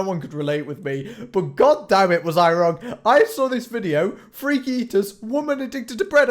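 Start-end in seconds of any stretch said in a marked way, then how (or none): 1.3-6.3 loud, high, hyped announcement; excited. Real, not acted. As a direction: build it up loud and thrilled. Hyped reveal of video.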